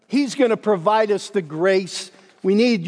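usual speaking voice

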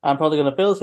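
ordinary talk

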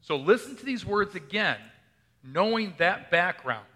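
ordinary talk, nothing marked

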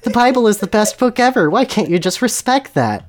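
high, mock-upset pleading; feminine sobbing. Hermione begs respect for the Bible, mock sobbing. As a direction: plead it high, pretending to sob. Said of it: "Hermione sobbed femininely".

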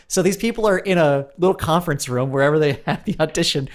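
usual speaking voice

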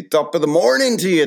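high, loud stage-Irish greeting; Irish accent. Shown as a demonstration. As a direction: sing out a hammed-up Irish accent.

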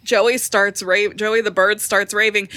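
ordinary delivery

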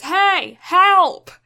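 loud high cry of hey, help; calling for help. Retold or imitated. Girl on the roof yelling down to firefighters, loud call for help.

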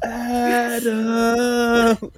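high drawn-out whine; whiny Roddy. Retold or imitated. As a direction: stretch the name out, whining. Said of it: "this whiny voice".

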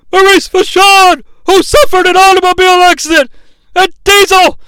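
high, loud, appalled commentator cry; outraged wrestling commentator. Retold or imitated. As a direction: imitate a horrified ringside announcer shouting. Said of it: "and we hear this".

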